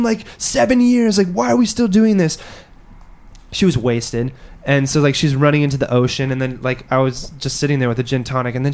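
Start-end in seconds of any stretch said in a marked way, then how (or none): 0.0-2.4 high-pitched mock distraught pleading; hammed-up upset girlfriend; imitating bawling. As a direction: imitate a tearful rant, pitched well up. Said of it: "she's, like, crying, like, bawling".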